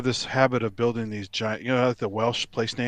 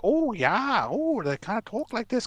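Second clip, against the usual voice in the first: much higher Wisconsin twang, Scandinavian lilt; sing-song upper Midwest imitation; 12 semitones higher than usual. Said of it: "which has a twangy thing".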